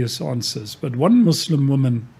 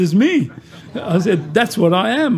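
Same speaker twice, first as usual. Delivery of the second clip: higher, laughing as he says it; laughing through it; 5 semitones higher than usual. Real, not acted. Preacher laughing while telling the woman he is that person. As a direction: deliver the reveal through a laugh.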